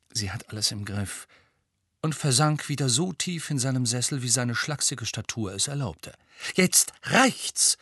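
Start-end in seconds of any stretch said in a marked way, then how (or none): 0.1-1.3 softer, murmured aside to a friend; murmuring. A narrator's reading. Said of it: "Dann murmelte er Harry zu". 6.5-7.8 firm, higher, decisive put-down; determined. A narrator's reading. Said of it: "sagte Hermine entschlossen".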